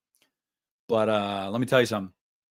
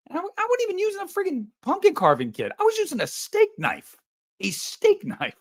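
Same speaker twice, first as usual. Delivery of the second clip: indignant squeak. Real, not acted. Indignant squeal about steak knife.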